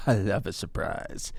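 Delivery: goofy low aside; silly low voice. Acted. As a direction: play it dumb and delighted. Laughing silly voice in ad.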